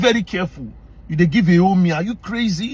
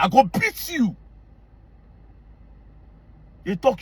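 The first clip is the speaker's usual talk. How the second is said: normal talking voice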